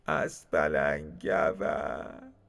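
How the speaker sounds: very slow, drawn-out weepy voice; low, slow, tearful put-on voice; tearful repeat. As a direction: drag the words out as if crying. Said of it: "و صدا تکرار کرد".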